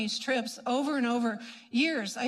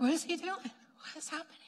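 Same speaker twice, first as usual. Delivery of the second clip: higher, whispered puzzlement; retold hushed bafflement; 4 semitones higher, 6 dB quieter, faster than usual. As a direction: whisper it like a confused onlooker. Baffled onlookers during quiet talk.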